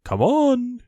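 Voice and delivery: high loud call. A narrator's reading. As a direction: shout it up the line. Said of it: "called Owl".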